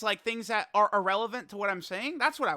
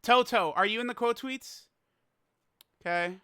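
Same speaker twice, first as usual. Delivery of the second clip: ordinary delivery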